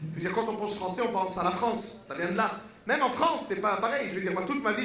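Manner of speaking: usual speaking voice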